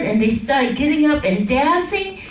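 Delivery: ordinary talk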